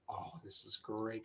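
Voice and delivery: very soft, low retold thought; hushed aside of pleased realisation; quiet delight. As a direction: savour it privately, under your breath. His thought while watching the finish.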